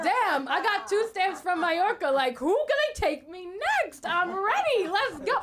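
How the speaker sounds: high, loud bragging; cocky excitement. Acted. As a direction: hype yourself up like a big-shot traveler. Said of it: "I actually think I'm that bitch".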